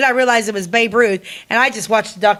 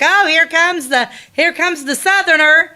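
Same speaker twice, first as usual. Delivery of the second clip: slow, high teasing sing-song, imitating; drawn-out mock announcement of the Southerner, higher pitched; mocking Chicago locals; 7 semitones higher than usual. Chicago coworkers teasing the visiting Southerner, slow and higher. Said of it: "they'd be like".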